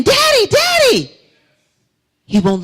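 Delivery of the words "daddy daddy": loud, high, slow holler. Retold or imitated. Daughter hollering at father who won't look, loud high holler. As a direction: holler his name as if he's far off. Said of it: "I holler again".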